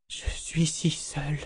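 unvoiced, trembling whisper of loneliness; terrified whisper. A narrator's reading. Kalan lost among the dead, whispering in fear.

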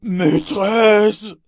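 high, loud drawn-out monster cry; cry for help. A narrator's reading. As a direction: call out as if summoning rescue. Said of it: "cria le monstre comme s'il appelait au secours".

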